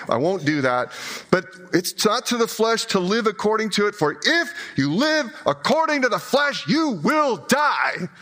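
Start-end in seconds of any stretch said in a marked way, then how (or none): 1.3-8.1 little preacher. Acted. Pastor mimics the condemning preacher in our heads, hellfire preaching. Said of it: "that's 'cause of the voice that's in my head".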